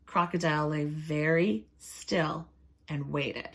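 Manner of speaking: ordinary delivery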